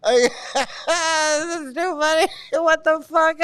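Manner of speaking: high-pitched, laughing disbelief; helpless laughter. Real, not acted. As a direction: force the words out through laughter. Still cracking up at the verse.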